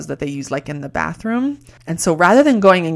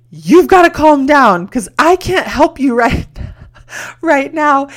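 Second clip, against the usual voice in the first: loud, high retold telling-off; high-pitched reprimand broken by laughter; laughing mock scolding; 9 semitones higher, 9 dB louder than usual. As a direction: scold someone while cracking up at the memory. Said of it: "scolded her".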